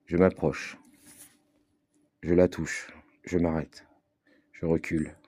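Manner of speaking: measured poetic recitation with long pauses; spoken-word reading, unhurried and spaced out; literary reading. Acted. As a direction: read it like a solemn prose poem, letting each phrase hang. Reads own literary text aloud.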